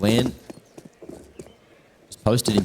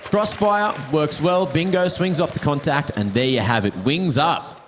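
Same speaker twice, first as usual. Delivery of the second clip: excited; 6 semitones higher than usual. Real, not acted. Calling the round's deciding kill.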